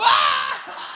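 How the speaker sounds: put-on very high, loud horrified child scream; desperate scream. Boy told he'll live with the old ladies, loud panicked scream. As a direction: shriek in panic at the thought. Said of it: "Aí, desespero".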